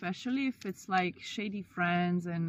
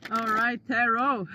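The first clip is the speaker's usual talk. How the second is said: excited; 3 semitones higher, 7 dB louder than usual. Real, not acted. Hyped reaction to cards.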